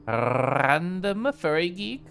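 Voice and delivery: Random Furry Geek very slow, every R trilled; drawn-out name with trilled R's; rolled R's, slow. Shown as a demonstration. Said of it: "I love rolling my R's".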